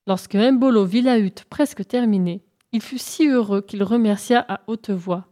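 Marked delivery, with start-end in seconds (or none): none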